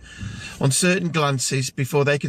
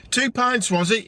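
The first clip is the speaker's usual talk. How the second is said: mild call across, pitched up; calling over; 7 semitones higher than usual. A narrator's reading. Barman checks the drinks order, calling over. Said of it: "he shouted over".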